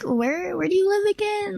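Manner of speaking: high mocking voice of a pushy fan; mock creepy guy.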